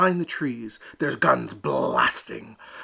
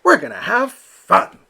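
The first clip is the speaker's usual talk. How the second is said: high, louder, eager; cheerful promise; 10 semitones higher, 9 dB louder than usual. Acted. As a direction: sell the fun ahead. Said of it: "Elmer chided".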